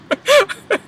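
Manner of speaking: squealing high laugh; high cackle. Real, not acted. Vlogger cracking up at wall-kicking story, high cackle.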